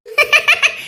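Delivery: high burst of giggles; giggling. Acted. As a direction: giggle helplessly at the scolding. Giggling after a teasing threat.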